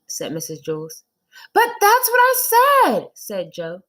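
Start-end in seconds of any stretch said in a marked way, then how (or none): 1.5-3.1 put-on high, loud protesting kid; child Joe. Boy insists to his teacher he counted right, high and indignant.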